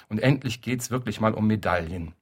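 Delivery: usual speaking voice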